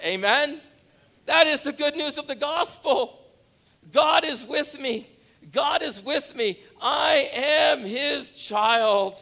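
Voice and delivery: high, loud, slow shouted proclamation; preacher shouting to the rafters; shouted preaching. Acted. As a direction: cry it out in triumph.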